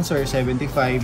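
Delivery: usual speaking voice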